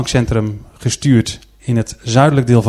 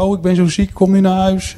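imitating a sick mother, higher and plaintive; pitiful little voice; 7 semitones higher than usual. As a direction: put on a high, pitiful sickly voice. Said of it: "zei met een zielig stemmetje". (zielig stemmetje)

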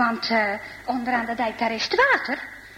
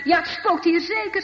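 higher, teasing parody of the cyclist; mock the cyclist's fear with a grin; mocking echo; 5 semitones higher than usual. Gert teases his friends by mimicking the scared cyclist. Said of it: "Weet je wat hij zegt?".

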